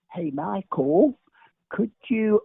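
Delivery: drawled-out approach, half speed; imitated slow talker. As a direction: stretch the name out like someone in no hurry. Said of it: "Anybody who talks slow, I'm off".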